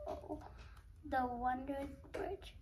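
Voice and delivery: plain reading voice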